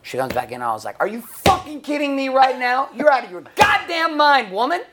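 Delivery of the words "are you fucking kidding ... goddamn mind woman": full-voice, high-pitched angry yell; outraged shouting. Retold or imitated. Husband yelling back at his wife in a fight, outraged shout. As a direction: explode at her in disbelief.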